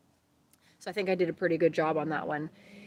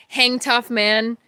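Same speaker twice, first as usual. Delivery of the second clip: rallying call; 5 semitones higher, 9 dB louder, much slower than usual. Real, not acted. Cheering on the viewers.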